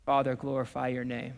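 low, soft surrender to the Father; hushed prayer. Retold or imitated. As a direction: pray it quietly and reverently. Voices Jesus's yielding prayer.

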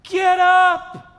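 high, loud preacher's call; proclaimed command. Acted. Preacher relaying God's message to Elijah, proclaiming loudly. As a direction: proclaim it as a rousing order.

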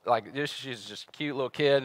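ordinary talk, nothing marked

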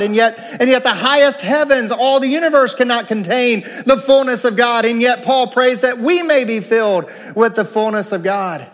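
rising, fervent preaching voice. Acted. Pastor urging the congregation, fervent preaching. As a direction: build like a revival sermon.

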